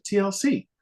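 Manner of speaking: high-pitched retold band name; quick, high answer; matter-of-fact announcement. Woman names her famous band.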